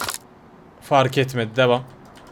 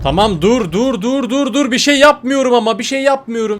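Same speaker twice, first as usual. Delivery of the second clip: high, louder pleading stop stop; panicked stop; 7 semitones higher, 4 dB louder than usual. Pretend. Player pleading with the angry giant, high panicked protest. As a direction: plead frantically that you did nothing.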